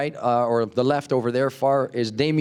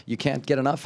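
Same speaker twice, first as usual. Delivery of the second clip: usual speaking voice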